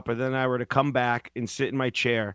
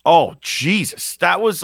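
much louder, slow, drawn-out exclamation; exasperated outburst; 5 semitones higher, 9 dB louder, slower than usual. Real, not acted. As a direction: groan it out loud in disbelief. Reacting to Nets story.

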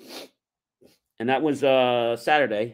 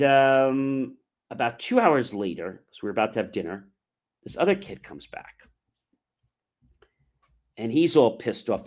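ordinary delivery

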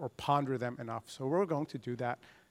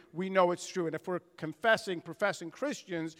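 ordinary delivery

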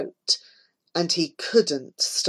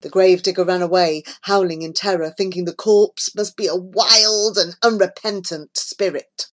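ordinary delivery

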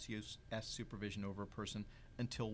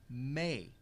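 "may" set apart by a pause and said louder; stress on may; 4 dB louder than usual. Real, not acted. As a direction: lean on "may", standing alone after a beat. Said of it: "I emphasize the word may".

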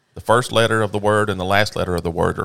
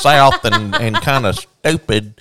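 hammed-up Southern drawl through laughter; laughing, thick Southern accent, slow at the end; mock Southern accent; 4 semitones higher, 5 dB louder than usual. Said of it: "a Southern person really lays it on thick".

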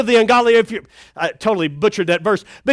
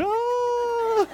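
soft startled yelp; 13 semitones higher, 9 dB quieter than usual. Retold or imitated. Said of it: "getting hit by that thing going".

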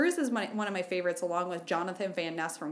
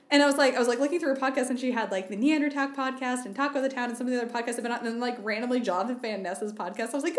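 ordinary talk

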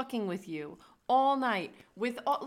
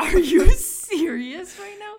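shocked; 4 semitones higher, 11 dB louder, slower than usual. Real, not acted. Shocked, laughing.

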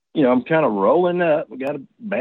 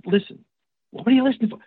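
annoyed retort; 8 semitones higher, faster than usual. Retold or imitated. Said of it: "if I tell my wife".

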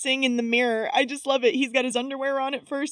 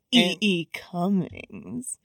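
"E E Cummings" slowly drawn out, lower; mock poetic; 4 semitones lower, much slower than usual. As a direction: stretch out "E E Cummings" like a would-be cool poetry lover. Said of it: "trying to quote some sort of poem to her to seem cool".

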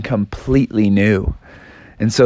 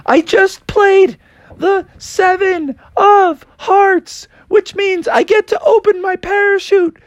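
high frog voice; slower than usual. Acted. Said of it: "And he said".

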